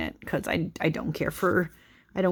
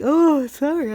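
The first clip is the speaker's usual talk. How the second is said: yawning; 9 semitones higher, 5 dB louder than usual. Real, not acted. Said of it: "I'm yawning".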